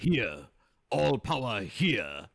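put-on slow broken-English accent, each word set apart; Mister Miyagi. Showing how nobody really talks, mock broken-English accent. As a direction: mimic halting broken English, drawn out. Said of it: "people who speak broken English".

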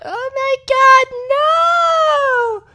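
very high, drawn-out wail of despair; horrified cry. Retold or imitated. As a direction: stretch it out in a high, anguished mock wail. Player's despair at losing camera.

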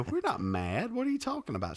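retold disbelief. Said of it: "I'd go".

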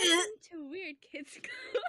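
high, slow, laughing bimbo greeting; ditzy bimbo voice breaking into laughter. Pretend. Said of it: "Stop. I don't like that".

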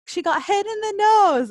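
high-pitched, insistent announcement; mock announcing. Imitating the guy telling everyone around, called out high. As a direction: call it out to the onlookers. Guy repeats his explanation to onlookers.

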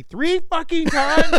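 loud, high, half-laughing rant; mock exasperated yell. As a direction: blow up at the absurdity. Retold exasperated outburst.

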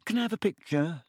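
put-on high, hopeful young boy voice; nervous, hopeful schoolboy asking a favour; eager boy. A narrator's reading. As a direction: plead politely for a photo. Colin shyly asks for photo.

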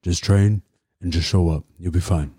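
lightly voiced coach, short phrases with pauses; understated coach impression; George impression. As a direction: imitate a coach's easy reassurance. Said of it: "So George would be like".

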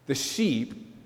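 ordinary delivery

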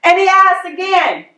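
ordinary talk, nothing marked

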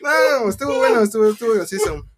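high, loud, laughing to tears; tearful laughing. Real, not acted. Storyteller insisting to teasing friends it was funny, tearful laughing. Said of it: "pero si estás llorando".

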